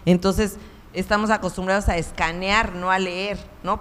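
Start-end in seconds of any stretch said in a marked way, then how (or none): none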